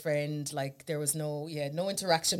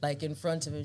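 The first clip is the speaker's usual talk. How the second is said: ordinary talk, nothing marked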